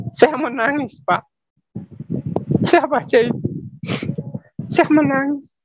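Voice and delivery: choked up, pleading to God; tearful. Real, not acted. Man recalling his conversion, voice breaking into tears. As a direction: voice rising high with emotion, near tears.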